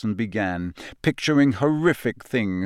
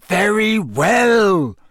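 loud, high, long-drawn exasperation; explode, stretching both words; exasperated; 10 semitones higher, 6 dB louder, much slower than usual. A narrator's reading. Teacher cutting off squeaking pupil, exasperated.

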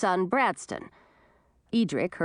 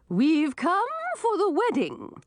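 high, slow and airy fairy; a lofty fairy, drawn out; 12 semitones higher, slower than usual. A narrator's reading. Swamp Fairy voiced in story.

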